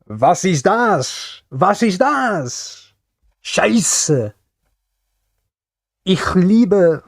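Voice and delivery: high, drawn-out mock German cries; mock screaming German. Streamer showing off scant German knowledge, mock screaming German. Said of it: "Literally screaming, "Was?".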